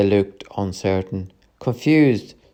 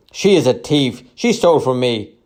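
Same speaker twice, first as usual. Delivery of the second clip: loud, raised angry bark; barked accusation; 5 semitones higher, 5 dB louder than usual. A narrator's reading. Trader accusing the woman to her rescuer, barking. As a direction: bark it out with pitch falling away. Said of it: "one man barked at me".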